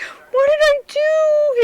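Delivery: very high, drawn-out wail; imitated upset child. Nephew dismayed at scaring his aunt, high mock wail. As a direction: wail it slowly in a little nephew's voice. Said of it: "he was just like".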